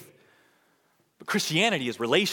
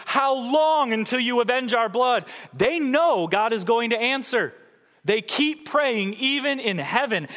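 high, loud anguished plea; crying out; 11 semitones higher, 8 dB louder than usual. Retold or imitated. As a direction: shout the martyrs' cry to God. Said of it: "they are crying out".

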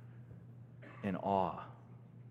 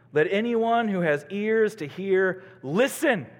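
emphatic proclamation; 9 semitones higher, 9 dB louder than usual. Real, not acted. Preacher proclaims a scripture call.

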